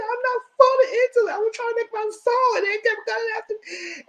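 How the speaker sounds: high wailing, sobbed words; mock crying. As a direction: whine it through fake tears. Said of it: "He said".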